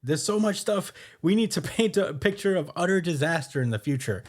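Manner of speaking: high-pitched excited newsman; hyped Big News Morgan. Retold or imitated. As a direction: proclaim it with rising excitement. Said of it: "he's like".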